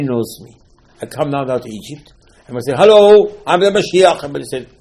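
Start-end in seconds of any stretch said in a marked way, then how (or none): 2.8-4.2 higher, louder mock self-announcement; grand announcement. Moshe introducing himself to Egypt, mock grand. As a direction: present yourself grandly, tongue in cheek.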